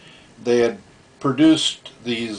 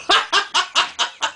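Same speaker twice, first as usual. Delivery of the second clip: high burst of laughter. Real, not acted. Laughing at the memory.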